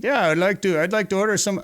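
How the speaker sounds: a clumsy, high-pitched attempt at a Finnish accent; bad Finnish accent. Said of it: "I don't know how to do a Finnish accent".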